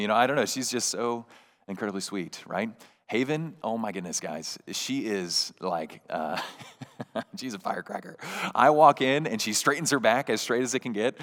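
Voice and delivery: usual speaking voice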